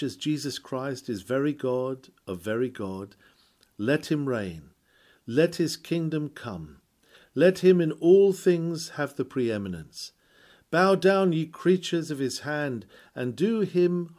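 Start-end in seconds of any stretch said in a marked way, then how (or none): none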